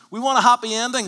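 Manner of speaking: high, loud passionate exclamation; impassioned cry. Real, not acted. Preacher pleads for a happy ending, crying out. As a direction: cry it out with conviction.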